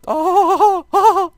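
very high, loud laughing oh-oh; a delighted cartoon squeal; giddy Mario squeals. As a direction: squeal with glee like a cartoon plumber. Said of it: "It's like".

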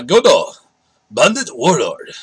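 names read in a put-on Japanese accent; a light Japanese accent on the card name. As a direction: pronounce Godo, Bandit Warlord the Japanese way. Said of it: "tried to do the pronunciations with an accent".

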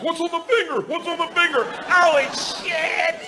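high-pitched eager dog voice; be a frantic dog begging for a sniff; excited dog. Retold or imitated.

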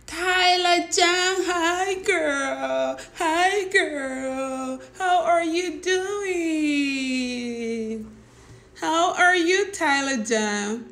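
put-on high, drawn-out girly greeting; a playful high mock of a viewer's hello; mimicking a viewer. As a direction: squeal the greeting like a fan.

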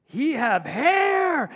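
put-on very loud, high, drawn-out child's wail; hammed-up horrified little girl; alarmed child. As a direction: cry it out slowly in broken child English. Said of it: "And she goes".

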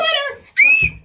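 sudden loud yell; startled shout across the room. Real, not acted. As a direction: bark the word out at full volume. Mom yells to stop filming.

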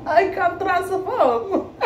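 drawn out high through giggles; slow giggling boast; laughing. Real, not acted. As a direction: stretch it out, laughing at yourself. Joking about transformation.

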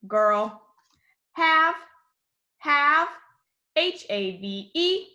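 steady teacher call-out of words and letters; sight word drill. Shown as a demonstration. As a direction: say each word, spell it letter by letter, say it again.

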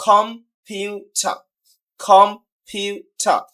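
Computer very slowly as com, pu, ter; three separate syllables. Shown as a demonstration. Teacher counting out three syllables, slow and deliberate. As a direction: break computer into three spaced beats. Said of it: "ট্রাইসিলেবল হচ্ছে তিনবার উচ্চারিত হয়".

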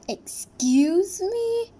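slow, loud offended excuse me; indignant. Acted. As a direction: drag it out, outraged. Offended princess reacts.

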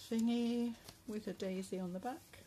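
ordinary talk

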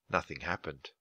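plain reading voice

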